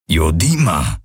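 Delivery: deep alien rumble; low grumble. A narrator's reading. Hawks grumbles a warning to Kirai, deep and low. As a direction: growl the word low in the chest. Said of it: "wiederholte Hawks".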